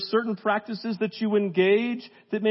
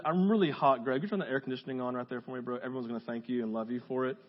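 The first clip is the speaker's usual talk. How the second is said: aside to Greg; 8 semitones lower, 7 dB quieter, faster than usual. Real, not acted. Asks for air conditioning.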